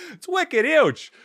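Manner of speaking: a deliberately bad Boston impression; horrible Boston accent. Host joins the bit with a horrible Boston accent. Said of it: "that's a horrible Boston accent".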